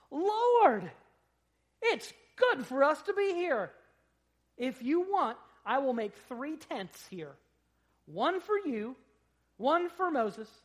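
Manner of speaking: very high, loud, breathless awe; awed Peter. Acted. Peter speaking to Jesus at the transfiguration, high awed cry. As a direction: Peter overwhelmed on the mountain. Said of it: "And Peter said to Jesus".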